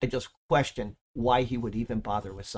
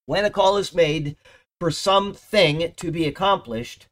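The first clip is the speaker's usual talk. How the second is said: raised, emphatic sermon voice; preaching; 4 semitones higher, 4 dB louder than usual. Acted. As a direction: preach it with conviction to the congregation. Preaching from the pulpit.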